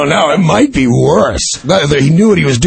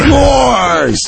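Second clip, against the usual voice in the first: a sudden, high, shouted "of course"; emphatic shout; 10 semitones higher than usual. Real, not acted. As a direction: burst out insisting it's obvious. Emphatic agreement in discussion.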